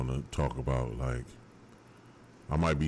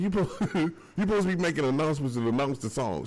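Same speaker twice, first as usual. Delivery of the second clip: laughing scold; 9 semitones higher than usual. Real, not acted.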